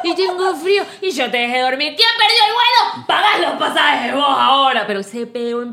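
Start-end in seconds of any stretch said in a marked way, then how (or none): 0.0-0.7 mocking whine. Argentine spouse mocking the other in airport fight, mocking whine. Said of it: "Lo remedaba, lo remedaba". 1.0-4.8 high, loud Argentine scolding; Argentine quarrel. Retold or imitated. Imitating angry spouse at the airport over lost flight, loud quarrel. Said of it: "Se han armado un peo".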